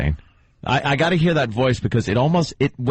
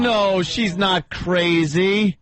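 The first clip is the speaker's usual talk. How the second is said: slow, higher mock-protest voice; imitated granddaughter; 5 semitones higher, much slower than usual. Host mocking the girl defending grandma, drawn-out protest. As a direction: stretch every word in mock indignation.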